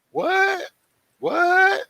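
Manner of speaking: squeaky high What, said twice; mock disbelief. Vlogger mocking the car dealer's refusal, high-pitched disbelief. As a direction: jump way up in pitch in fake shock.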